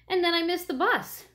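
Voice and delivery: high-pitched schoolgirl exclamation; exclaiming. A narrator's reading. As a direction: exclaim it as an upset excuse, pitched well up. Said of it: "exclaimed Jenny".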